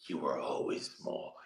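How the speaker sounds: very low, hushed retort; imitating a soft, cutting comeback; cold cutting reply. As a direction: deliver it quietly as a deadly put-down. Voices woman's cutting reply.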